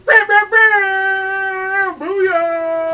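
very slow, high, loud hype; hype shout. Acted. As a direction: stretch each word like a hype man. Hyping up a draft pick.

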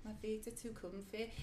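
hushed stab at Manchester English; imperfect Manchester accent. Shown as a demonstration. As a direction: quietly demonstrate a Manchester accent. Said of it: "No, that's not an Irish accent".

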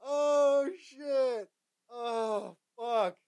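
high, drawn-out groans after a laughing fit; spent from laughing. Real, not acted. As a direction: recover from helpless laughter.

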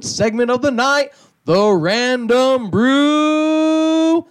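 booming circus ringmaster intro. Pretend. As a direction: announce it like a big top show. Segment intro announcement.

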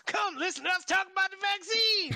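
mocking an AM radio announcer. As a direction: pitch it like a cheesy AM station promo. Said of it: "where they're like".

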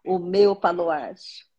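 slow, devout reading of a psalm; prayerful psalm reading. Acted. Mother reading her daily psalm to viewers, slow and devout. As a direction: read each verse slowly like a prayer.

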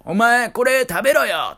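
mock a bossy mother's loud order; loud mother's scolding. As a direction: imitate a loud, high-pitched mum ordering her kid. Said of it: "大声で喋っていて".

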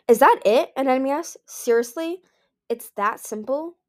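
can't believe it's that easy; delighted surprise, slowing at the end; amazed disbelief. A narrator's reading. Anemone amazed at simple spell.

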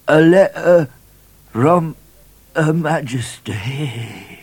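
high, halting sailor's voice; dying sailor. A narrator's reading. Sailor handing the Queen's letter to the governor, dying gasp. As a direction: force out each phrase, high, with long gaps.

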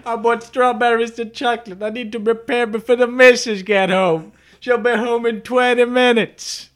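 fussing like a husband racing home; mock hurried husband. Imagined driver frets about his wife, high put-on voice. She continues voicing driver.

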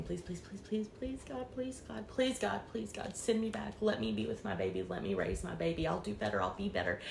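high, soft, breaking plea; desperate begging. Retold or imitated. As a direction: beg desperately in a high hush. Begging God to return.

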